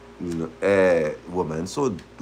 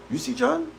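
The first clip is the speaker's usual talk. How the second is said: high, soft, anxious asking; lightly voiced girl hunting for her man; imitating girlfriend asking; 12 semitones higher, 7 dB quieter than usual. Girlfriend searching the party.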